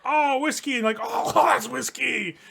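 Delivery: high, loud sputtering shout; coughing shout. Retold or imitated. Coughing drunk kids.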